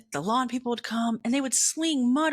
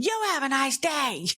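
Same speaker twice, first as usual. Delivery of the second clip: mildly raised retort, a bit higher; mock yell; 6 semitones higher than usual. Snapping back at the supervisor while stomping off, mild mock yell. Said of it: "I know I'm yelling".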